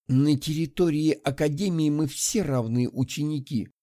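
usual speaking voice

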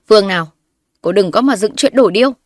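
loud, defensive brush-off; flustered denial. A narrator's reading. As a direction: deny it too loudly.

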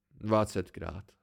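low, slow, grudging growl. A narrator's reading. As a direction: mutter it like a growl under his breath. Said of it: "zavrčel Dedan". (nízké pomalé zavrčení)

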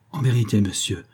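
usual speaking voice

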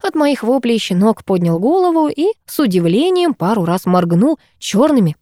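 usual speaking voice

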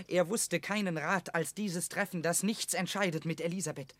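normal talking voice

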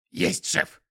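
skunk voice. A narrator's reading.

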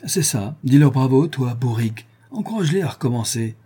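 annoyed woman scolding her husband; irritated. Acted. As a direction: snap at him for praising them. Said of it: "s'énerva Armande".

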